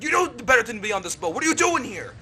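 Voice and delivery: a father scolding his son; stern father. Acted. Thompson scolding Frill a second time. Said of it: "He's like".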